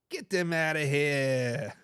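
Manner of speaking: low, drawn-out Boston accent; slow it right down in an attempted Boston accent. Shown as a demonstration. Echoing a viewer's jab at the Cowgirls, Boston accent. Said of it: "best Boston accent I can get".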